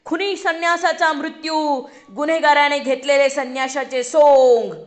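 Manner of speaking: shouted headline. A narrator's reading. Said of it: "ती पुन्हा पुन्हा ती वाक्य ओरडू लागली".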